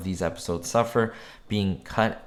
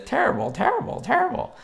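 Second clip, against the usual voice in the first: very high, squawky parrot voice; squawking parrot. Retold or imitated. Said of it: "a parrot that says".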